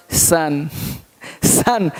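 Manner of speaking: "सन" with a short uh vowel, pitched low; correct pronunciation. Shown as a demonstration. Teacher gives the right reading, correct pronunciation. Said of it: "ओ का sound यहाँ पे आ".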